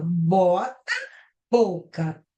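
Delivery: "bota" and "boca" slowly, leaning on each "bo"; "bota... boca", each first syllable held out; first syllable stressed. Shown as a demonstration. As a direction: stretch the opening "bo" of "bota", pause, then "boca". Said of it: "Primeira sílaba".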